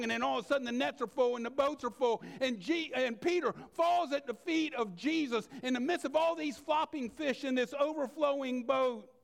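mounting sermon energy; preaching build. Acted. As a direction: drive the story forward with rising fervor. Preaching builds on Peter story.

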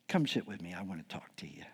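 hushed, low, near-whispered invitation; coaxing. Acted. Paul drawing them close before the smack, softly coaxing. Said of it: "Here's what he's doing".

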